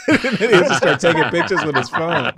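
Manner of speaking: laughing hard through words; giggling while telling it. Real, not acted.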